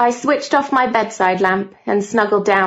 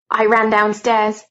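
ordinary delivery, nothing marked

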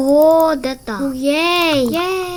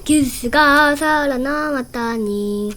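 normal talking voice

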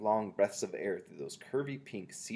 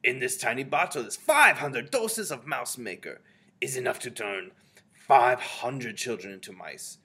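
loud, higher Grand High Witch proclamation; shouted; 5 semitones higher, 10 dB louder than usual. A narrator's reading. Witch showing the tiny bottle to her followers, shouted. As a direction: shout it out with emphatic weight on the numbers. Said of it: "She held it up and shouted".

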